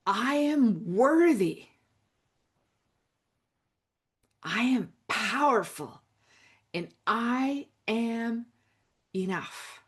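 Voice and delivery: earnest self-affirmation, slow and sincere; spoken affirmation. Shown as a demonstration. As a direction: say it like you finally believe it. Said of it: "Say this with me right now".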